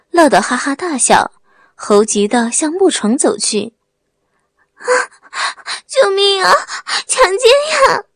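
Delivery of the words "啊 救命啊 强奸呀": screaming for help. Acted. Bride struggles against husband, shrieking for help. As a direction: scream it out, voice cracking with terror. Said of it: "使尽了吃奶的力气，拼命挣扎".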